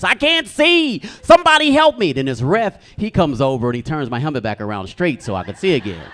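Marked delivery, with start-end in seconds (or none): 0.0-2.1 panicked scream. Retold or imitated. Blinded player screams at everyone for help, high panicked yell. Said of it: "I'm screaming at folks".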